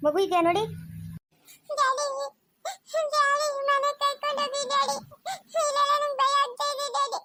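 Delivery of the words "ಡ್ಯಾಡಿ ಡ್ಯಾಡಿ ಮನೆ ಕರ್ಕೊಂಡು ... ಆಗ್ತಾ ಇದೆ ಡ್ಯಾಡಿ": put-on high child's voice, pleading for daddy; scared little girl. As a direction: play a frightened small girl calling her father. Adult voices frightened lost girl. (ಹೆದರಿದ ಪುಟ್ಟ ಹುಡುಗಿಯ ಧ್ವನಿ)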